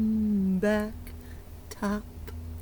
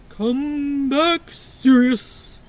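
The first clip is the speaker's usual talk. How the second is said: louder, mock-stern line; mock serious; 6 dB louder, much slower than usual. Shown as a demonstration.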